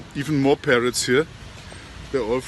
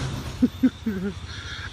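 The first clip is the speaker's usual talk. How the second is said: high, airless giggle; real amused giggle; breathless giggle before the punchline; breathy laugh; 7 semitones higher, 5 dB quieter than usual. Real, not acted. Laughing at his own story.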